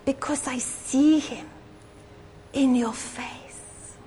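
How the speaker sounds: slow, hushed whisper of the lady; soft Mexican lady whispering slowly; the way she said it. Retold or imitated. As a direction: whisper it slowly as a tender revelation. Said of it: "it's the way she said it".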